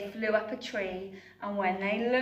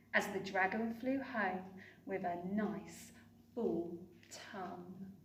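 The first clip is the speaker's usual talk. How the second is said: ordinary delivery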